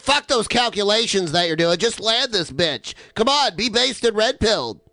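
mock impatient demand. Passenger yelling at pilot to land, mock impatient. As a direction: play an exasperated passenger ordering the pilot. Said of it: "when he's on an airplane about to land".

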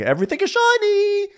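very high, squealing put-on glee; hammed-up burst of high-pitched excitement; mock enthusiasm. Enthusiastic crewmate in the morning, imitated high-pitched delight. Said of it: "Wes is enthusiastic as usual".

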